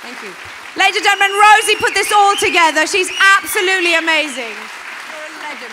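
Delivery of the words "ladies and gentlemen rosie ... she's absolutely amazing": loud, high host voice; rally the room for applause; stage announcement. Real, not acted. Host thanks Rosie on stage and announces her loudly to the audience.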